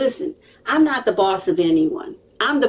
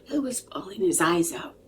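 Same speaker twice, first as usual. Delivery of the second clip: whispered narration; 6 dB quieter than usual. Real, not acted. Hushed storytelling aside.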